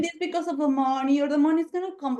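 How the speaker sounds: high mocking mimicry of the excuse; mock whiny excuse voice; mocking quote. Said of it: "shut up that fucking shit".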